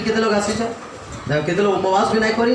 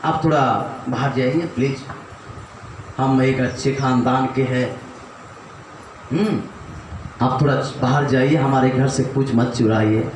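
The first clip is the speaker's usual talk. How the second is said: lower, meek, overly polite begging; mock polite plea; 7 semitones lower than usual. Homeowner politely begging a thief to leave, mock meek. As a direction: beg the thief nicely, a little lower. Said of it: "और आप बोल रहे हैं".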